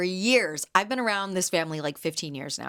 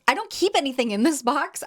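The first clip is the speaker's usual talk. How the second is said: ordinary talk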